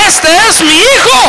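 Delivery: high, loud, proud declaration; proud father. Retold or imitated. God the Father proclaiming his son to the Spirit, proud loud declaration. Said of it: "el padre le dijo".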